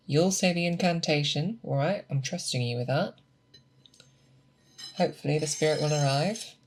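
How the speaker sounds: ordinary talk, nothing marked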